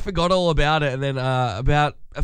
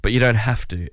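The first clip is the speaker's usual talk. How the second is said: normal talking voice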